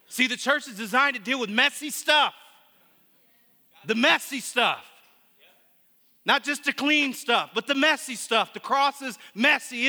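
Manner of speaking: full-voiced sermon delivery; fervent preaching. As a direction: preach it out to the whole room. Preaching to congregation.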